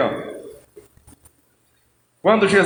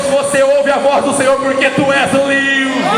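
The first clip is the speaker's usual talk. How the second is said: full-force preaching; 6 semitones higher than usual. Acted. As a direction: shout freedom over the congregation. (pregação inflamada, alta e aguda)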